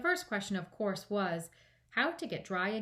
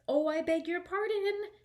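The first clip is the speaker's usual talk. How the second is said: high, loud, startled apology; alarmed apology; 10 semitones higher, 5 dB louder than usual. A narrator's reading. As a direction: blurt it out in alarm. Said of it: "cried Alice again".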